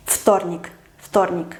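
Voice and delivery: "вторник" starting with a voiceless f; devoiced first consonant. Shown as a demonstration. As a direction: begin "вторник" on f, as ftornik. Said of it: "entonces f".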